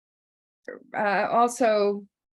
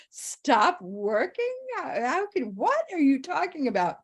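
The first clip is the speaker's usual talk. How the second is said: shocked disbelief; 7 semitones higher than usual. Retold or imitated. Retold shocked reply to mentor.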